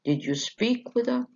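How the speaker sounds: "speak" carries the stress, "with her" unstressed and high; high question tune from "speak" onward. Shown as a demonstration. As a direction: ask it with "speak" accented, voice staying up on "with her". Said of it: "The last content word اللي هو the verb".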